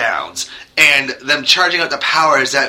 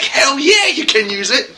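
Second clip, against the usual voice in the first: enthusiastic rocker; 10 semitones higher than usual. Retold or imitated. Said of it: "Be like".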